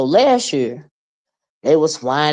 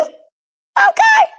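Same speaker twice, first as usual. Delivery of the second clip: sudden squealed okay, way up high; 5 dB louder than usual. Real, not acted. Signing off to viewers with a squealed okay. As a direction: squeal it out in delight. Shrieked sign-off after thanks.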